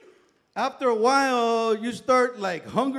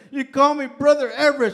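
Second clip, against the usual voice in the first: usual speaking voice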